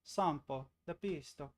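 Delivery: mock Italian accent. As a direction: say it like a playful fake Italian. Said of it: "said that in an Italian accent".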